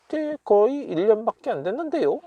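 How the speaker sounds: high-pitched, quick dialogue reply; lifted, fast skit-style protest; acted protesting reply. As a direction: play the character objecting, voice lifted and hurried. Acting the translated reply.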